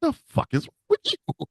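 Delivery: high, squeaky mock outrage; appalled disbelief. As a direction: ask it as if scandalized. Acted scolding of the commentator.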